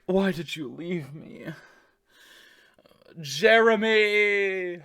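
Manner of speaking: high, drawn-out hammed-up wail; overacted soap-opera breakup cry; mock dramatic wail. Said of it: "she'll be like".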